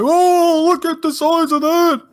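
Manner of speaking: put-on high, loud heckling old-man puppet voice; Statler and Waldorf. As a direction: heckle from the balcony in a hammed-up high voice. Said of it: "Statler and Waldorf ju-, uh, judging it like".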